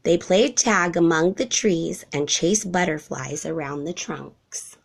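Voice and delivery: plain reading voice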